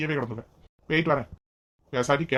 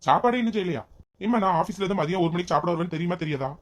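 raised, higher-pitched angry protest; shouting in complaint; 5 semitones higher, 4 dB louder than usual. Acted. Son to mother over lunch not being cooked, shouting in complaint. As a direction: demand an answer, voice up. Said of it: "அதை எதுக்கு என்கிட்ட கத்திட்டு இருக்க?".

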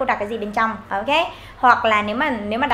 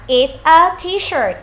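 slow, clear classroom demo; slow model answer; 4 semitones higher, 4 dB louder, much slower than usual. Shown as a demonstration. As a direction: say it slowly for learners to copy. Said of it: "các bạn sẽ hỏi là".

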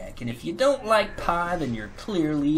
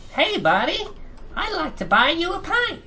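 very high, louder little gnome; 14 semitones higher, 3 dB louder than usual. Retold or imitated. Gnome offering Gadzooks a pint, very high squeaky voice. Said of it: "some gnome comes up to him and he's like".

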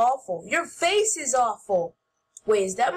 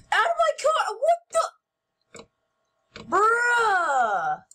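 much higher, shouting after the thief; outraged yell; 8 semitones higher, much slower than usual. Real, not acted. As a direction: yell it at the guy stealing your car. Yelling at the car thief.

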